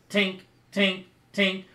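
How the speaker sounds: high, loud metallic tinks; sharp evenly spaced tinks, like hammer on metal; imitated hammer tinks. Game master imitating work in the smithy, high tink sounds. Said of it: "you can hear the tink".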